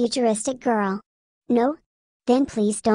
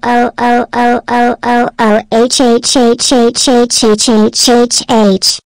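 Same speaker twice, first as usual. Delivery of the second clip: much louder chanted oh and H; loud alarm outburst; 12 dB louder, slower than usual. Acted. Miku blares the chance count at the user, much louder.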